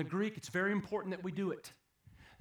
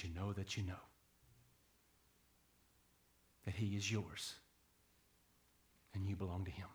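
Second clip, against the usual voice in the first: hushed appeal; 8 semitones lower, 7 dB quieter than usual. Acted. Preacher quietly draws the congregation in, hushed. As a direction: lean in and speak gently to the flock.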